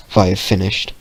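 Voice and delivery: usual speaking voice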